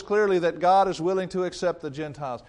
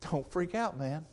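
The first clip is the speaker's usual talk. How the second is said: softer, easygoing reassurance; calming Peter; 8 dB quieter than usual. Acted. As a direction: settle them down gently. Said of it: "Peter has to be the one to say".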